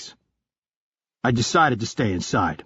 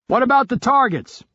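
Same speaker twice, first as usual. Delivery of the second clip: urgent report, much higher; higher teammate voice; 12 semitones higher, 4 dB louder than usual. Acted. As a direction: report the threat, tense and higher. Retro asks.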